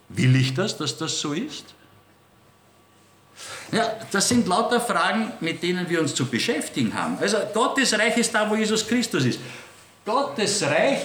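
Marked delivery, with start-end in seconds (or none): none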